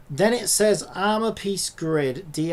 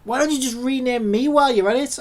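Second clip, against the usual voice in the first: higher, louder exasperated rant at the machine; emotional outburst; 7 semitones higher, 6 dB louder than usual. Real, not acted. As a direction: let the frustration spill out, loud and high. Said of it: "I'm getting very emotional".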